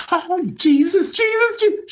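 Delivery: loud, very high imitated religious wailing; Holy Ghost meltdown. Wife learning he's the baby, mock Holy Ghost meltdown. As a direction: cry out like an overwhelmed churchgoer. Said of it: "She went into a Holy Ghost meltdown".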